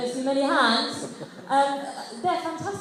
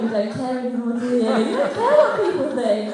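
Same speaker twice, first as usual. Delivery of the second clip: admiring inner voice; 7 dB louder, faster than usual. Retold or imitated. Thinking at the old woman.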